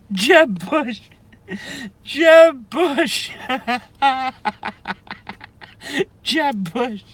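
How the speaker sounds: high squeaky giggles breaking through the name; laughing too hard to get the name out; cry laughing. Real, not acted. Streamer losing it over Jeb Bush, cry laughing. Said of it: "I'm starting to cry laugh".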